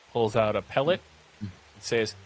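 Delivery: ordinary talk, nothing marked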